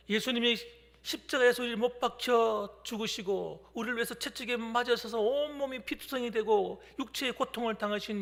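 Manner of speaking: loud, driving pulpit delivery; forceful preaching. Acted. Pastor preaching the cross to congregation, loud and forceful.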